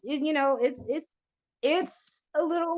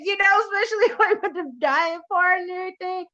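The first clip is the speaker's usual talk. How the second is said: laughing through the words, high and loud; cracking up while she praises him; 8 semitones higher, 6 dB louder than usual. Real, not acted. Host laughing while praising her guest, real laughter.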